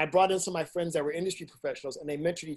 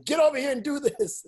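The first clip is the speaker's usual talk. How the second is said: higher-pitched mock yell at the crew; retold yell; 10 semitones higher, 4 dB louder than usual. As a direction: call out a bossy order, half in earnest. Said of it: "I would yell at them saying".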